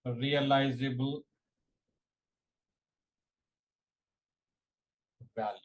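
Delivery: word-by-word dictation with long pauses; slow dictation. Real, not acted. Teacher dictating a definition to exam students, slow dictation. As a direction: dictate slowly, leaving time to write.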